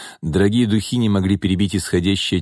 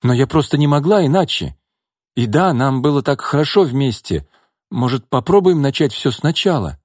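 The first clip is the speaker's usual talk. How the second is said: higher, hopeful woman's voice; 6 semitones higher than usual. A narrator's reading. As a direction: reach out, hoping he gives in. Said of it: "с надеждой спросила Нина".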